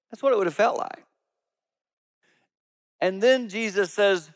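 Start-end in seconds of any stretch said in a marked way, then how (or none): none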